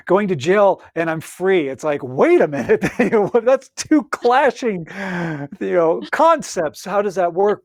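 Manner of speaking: loud, animated disbelief; mock bafflement. Host puzzling over jail as freedom, mock bafflement. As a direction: laugh in, then protest loudly.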